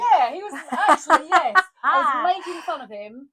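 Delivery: loud, high laughing admission; real excited giggly confession. Real, not acted. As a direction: burst out, owning up.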